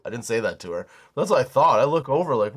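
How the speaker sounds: ordinary talk, nothing marked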